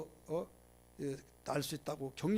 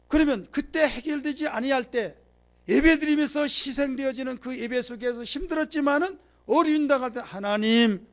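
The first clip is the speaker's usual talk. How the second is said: loud, high-pitched pulpit climax; forceful preaching; 8 semitones higher, 8 dB louder than usual. Real, not acted. Preacher urging the congregation about hardship, impassioned loud preaching. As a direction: drive it home at full preaching volume.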